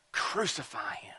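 unvoiced hushed whisper; whispered cry to crucify; whispered crowd. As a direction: breathe it like a chilling secret.

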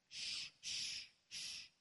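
long sharp shushes; hushing. Acted. Crowd quiets the shouting blind man, hushing. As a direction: shush someone like a disapproving crowd. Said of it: "They tried to quiet this guy down".

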